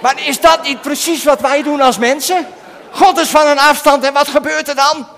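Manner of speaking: loud, high, fervent preaching; rising preacher shout. Acted. Preacher to the children, shouting the question. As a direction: call it out to the whole church.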